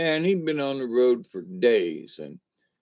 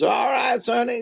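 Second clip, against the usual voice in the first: granny calling out; 11 semitones higher than usual. Retold or imitated. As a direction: shout it up high as the old granny. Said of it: "She goes".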